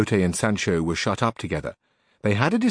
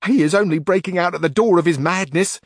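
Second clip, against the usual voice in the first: put-on much higher, louder worried old woman's voice; fretful housekeeper; 9 semitones higher, 5 dB louder than usual. A narrator's reading. Housekeeper tells Carrasco her master is breaking out, high fretful voice. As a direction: wail it like an anxious housekeeper, slowing at the end.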